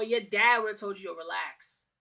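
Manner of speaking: ordinary talk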